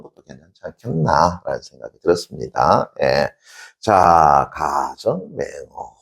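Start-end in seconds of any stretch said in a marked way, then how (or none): 4.5-5.9 drawn out slowly; slow recital. Shown as a demonstration. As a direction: say the idiom slowly. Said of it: "stating the idiom".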